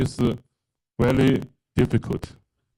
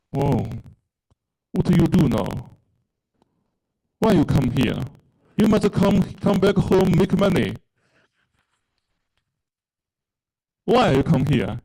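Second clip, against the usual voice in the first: imitating a higher scolding voice; mock scolding; 5 semitones higher than usual. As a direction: scold like a disapproving relative demanding a return home. Voicing bullying critics, retold.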